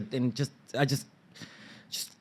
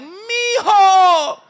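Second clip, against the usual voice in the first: high, drawn-out loud cry of greeting; overjoyed mother; 11 dB louder than usual. Retold or imitated. Mother greeting her son at the door, overjoyed. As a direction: shriek it out with exaggerated joy. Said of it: "my mother was like".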